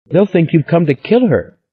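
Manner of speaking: put-on high, lively girlish voice; a lightly voiced excited young Stella; eager young woman. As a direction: spill the gossip breathlessly.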